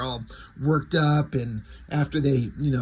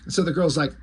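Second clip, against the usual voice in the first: ordinary talk, nothing marked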